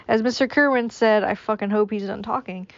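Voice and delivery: usual speaking voice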